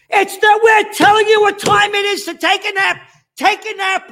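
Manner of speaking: shrill mock official bellowing orders; mock authority shriek. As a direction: screech it like a bossy official.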